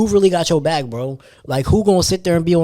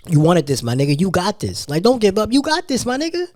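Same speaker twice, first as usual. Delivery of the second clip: hyped-up encouragement; 7 semitones higher than usual. Retold or imitated. Retelling how friends pushed him not to quit, climbing excited encouragement. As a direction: pitch climbing higher and higher, pumping him up. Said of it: "they be talking life into me".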